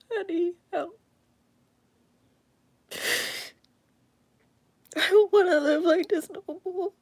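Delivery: pleading through tears, barely holding it together; real crying. Real, not acted. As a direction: voice breaking, pitch pushed up through sobs. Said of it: "that I cry".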